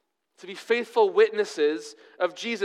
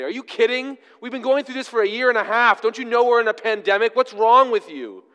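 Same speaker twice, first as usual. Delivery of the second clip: higher, louder mock tirade at a shopper; imitating angry berating; 7 semitones higher, 7 dB louder than usual. Pastor retelling the masked-shopper quarrel, loud and indignant. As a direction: rant at her like the angry woman did. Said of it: "the angry woman continued to berate her".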